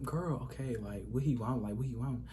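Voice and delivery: soft, under-the-breath puzzlement; hushed inner thought. Retold or imitated. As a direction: murmur it quietly to yourself. Inner thought while lying in bed.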